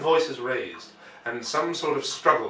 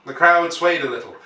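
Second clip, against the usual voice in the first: ordinary delivery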